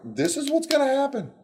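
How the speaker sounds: high, pointed warning; Moses warning. Acted. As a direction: declare it to Pharaoh. Moses warns Pharaoh.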